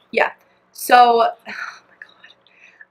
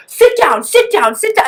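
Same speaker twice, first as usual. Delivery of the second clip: higher, louder mimicking of a bossy child; mock a kid giving orders; imitated bossy kid; 9 semitones higher, 4 dB louder than usual. Imitates kid ordering her around.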